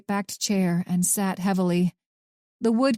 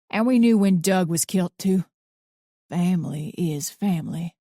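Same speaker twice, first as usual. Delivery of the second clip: put-on gruff old man. A narrator's reading. Arthur's voice.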